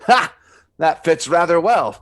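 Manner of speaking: loud gloating laugh into a smug line; triumphant. Acted. Jim gloats at the bridled witch. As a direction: crow over your beaten foe.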